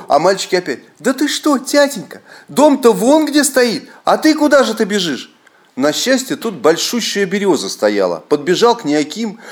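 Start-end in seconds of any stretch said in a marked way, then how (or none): 1.0-5.3 put-on children's voices. Sons puzzled at running father, high childish voices. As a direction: sound like small sons worried.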